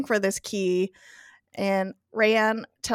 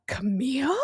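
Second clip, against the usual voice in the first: higher, incredulous questioning name; mildly shocked retold query; 4 semitones higher than usual. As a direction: say it as a stunned question. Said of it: "And he's shocked".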